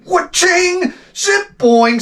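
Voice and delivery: high zany mock sound effects, wa-ching and zip boing; cartoon sound effects. Bike tangled in the shed, mimicking the clatter in high cartoon noises. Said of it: "it's like".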